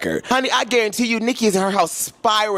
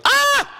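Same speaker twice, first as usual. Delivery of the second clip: delighted shriek. Real, not acted.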